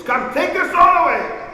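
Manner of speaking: loud, high summoning shout; calling servants. Acted. Invalid ordering unseen servants to clear up, loud call.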